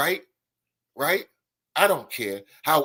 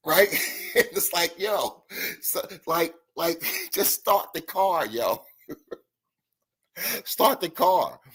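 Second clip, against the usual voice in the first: talking through real laughter; laughing; 3 semitones higher, slower than usual. Real, not acted. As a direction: crack up while trying to get the words out. Cracking up retelling the film clip.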